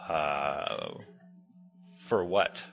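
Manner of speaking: low, doubtful "for what?"; a dry, doubting counselor; skeptical. Retold or imitated. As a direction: question it with suspicion. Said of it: "skeptical me".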